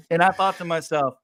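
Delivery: ordinary talk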